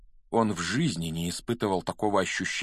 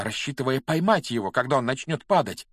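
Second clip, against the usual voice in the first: plain reading voice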